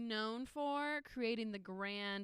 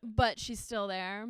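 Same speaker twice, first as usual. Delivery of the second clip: usual speaking voice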